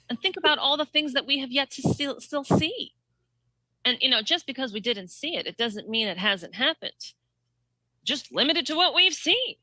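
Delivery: mock speech-making in a lifted voice; mimicked politician. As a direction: impersonate her rambling earnestly, pitch lifted. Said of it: "But then she goes".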